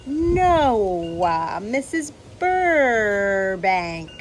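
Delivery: slow, prissy over-precise lady; haughty Mrs Teagle, very slow; prim neighbour. Acted. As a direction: drag it out like a snob correcting someone.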